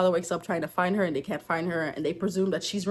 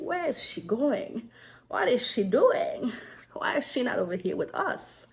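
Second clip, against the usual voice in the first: nosy whisper; 2 semitones higher than usual. Acted. Nosy neighbor spying, wondering aloud.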